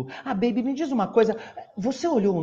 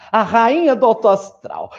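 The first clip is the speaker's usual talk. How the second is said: loud proclamation; 3 semitones higher, 9 dB louder than usual. Acted. Mona proclaiming herself again.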